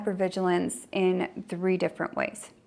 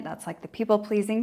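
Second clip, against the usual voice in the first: ordinary delivery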